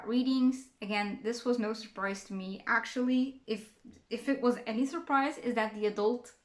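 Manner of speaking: normal talking voice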